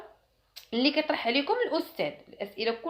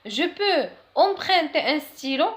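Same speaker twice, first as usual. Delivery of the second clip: slow, higher classroom request; slow model sentence; 5 semitones higher, 5 dB louder, much slower than usual. Shown as a demonstration. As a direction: ask for a pen slowly and clearly. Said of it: "model phrase for students". (جملة نموذجية ببطء وبصوت أعلى)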